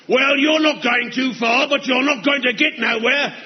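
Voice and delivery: usual speaking voice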